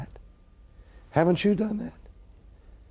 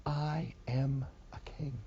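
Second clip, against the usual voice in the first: softer and slow, voiced; 9 dB quieter, slower than usual. Preacher repeats Jesus' claim to Rome in a soft slow voice. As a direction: lay the words down gently.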